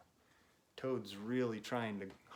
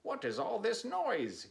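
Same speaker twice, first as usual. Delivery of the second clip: much higher voice for Frog; a brisk, higher Frog asking; Frog's voice; 11 semitones higher, 4 dB louder than usual. Acted. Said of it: "Frog came running".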